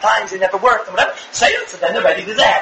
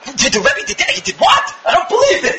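shocked disbelief; 5 semitones higher than usual. Shown as a demonstration. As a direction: play the parent who can't believe his ears. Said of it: "you do that, you're destroying him".

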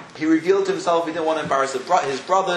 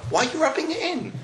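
very high-pitched puzzled complaint; incredulous protest; 12 semitones higher than usual. Acted. Teacher objects to Yosef's reminder, incredulous protest. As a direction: protest it like it makes no sense.

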